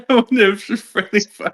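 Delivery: quoting it through laughter; giggling while echoing the line; laughing repeat. Real, not acted. Echoes the quote while laughing.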